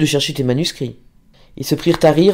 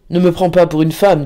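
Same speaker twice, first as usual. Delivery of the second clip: usual speaking voice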